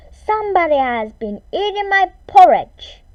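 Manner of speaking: big booming bear voice, loud; Papa Bear growl. A narrator's reading. Narrator voicing Papa Bear's angry growl. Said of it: "In his great big voice, he growled".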